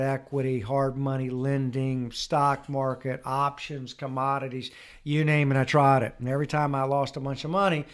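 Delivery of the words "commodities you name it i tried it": ordinary delivery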